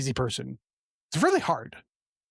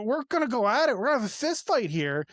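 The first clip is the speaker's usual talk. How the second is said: usual speaking voice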